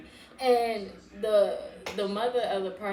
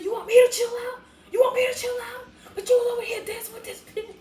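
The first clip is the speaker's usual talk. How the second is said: very high, loud incredulous squeal; retold cursing rant; 14 semitones higher, 7 dB louder, faster than usual. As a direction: throw it back in squealing outrage. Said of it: "Like, cursing".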